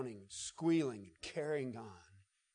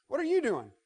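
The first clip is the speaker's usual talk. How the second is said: high, amused question; preacher voicing Jesus surprised at the stowaway; playful Jesus voice; 11 semitones higher, 8 dB louder than usual. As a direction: tease him lightly. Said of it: "Jesus turns around and says".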